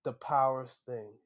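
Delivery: usual speaking voice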